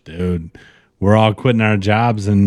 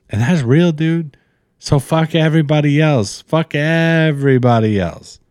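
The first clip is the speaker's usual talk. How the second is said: higher, emphatic hype; hyped-up; 6 semitones higher than usual. Real, not acted. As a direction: pump him up, no holding back. Hype pep talk climax.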